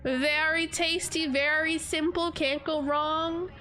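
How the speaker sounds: ordinary talk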